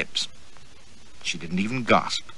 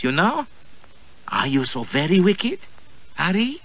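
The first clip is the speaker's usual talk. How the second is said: put-on high, eager, teasing girl's voice; 4 semitones higher than usual.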